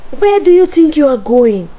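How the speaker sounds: high, loud witch screech; shrieked angry challenge. A narrator's reading. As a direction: screech it after the escaping child.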